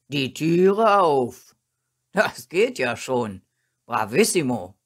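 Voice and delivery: loud master putting his dog through tricks; commanding dog trainer. A narrator's reading. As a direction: call the trick out, then praise. Master commands, then praises dog.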